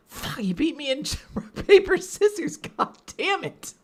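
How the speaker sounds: frustrated high outburst; sore loser. Retold or imitated. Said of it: "the small part of her was like".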